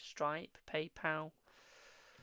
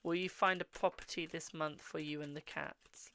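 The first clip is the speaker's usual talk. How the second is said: ordinary talk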